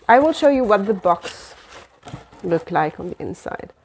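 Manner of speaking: usual speaking voice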